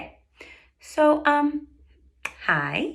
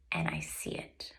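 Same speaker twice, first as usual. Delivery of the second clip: hushed whisper; 13 dB quieter than usual. Real, not acted. Hushed moment of her story.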